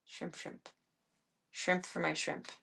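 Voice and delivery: hushed coo to the pet; soft cooing. Real, not acted. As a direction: murmur it softly to the cat.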